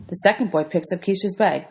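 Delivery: ordinary delivery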